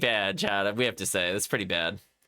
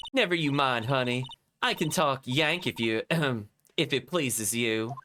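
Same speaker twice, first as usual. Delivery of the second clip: scolding country twang. Acted. Lotta voiced.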